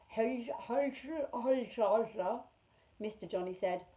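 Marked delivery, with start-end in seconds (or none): 0.1-2.4 gurgle the greeting like a shy man with slurred speech; chuckle gobble. Acted. Mister Johnny greeting the visiting children, gobbling chuckle. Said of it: "queer sound in his voice, chuckle gobble".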